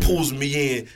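ordinary delivery, nothing marked

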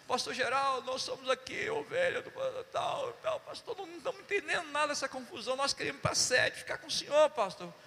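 high-pitched imitation of a worried caller; mock high, flustered appeal; pleading church members. Acts out anxious couple's phone call.

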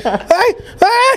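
very high yelled greeting; shouting. Retold or imitated. Host re-enacts yelling while the mic was off, high shout. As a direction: re-enact your own high-pitched holler. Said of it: "gua teriak".

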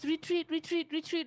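high, frantic repeated retreat call; frantic retreat order. Retold or imitated. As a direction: squeal it like a panicked teammate. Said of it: "the only thing I hear is".

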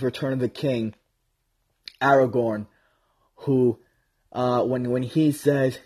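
ordinary delivery

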